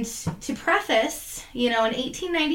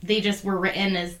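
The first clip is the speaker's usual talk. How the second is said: usual speaking voice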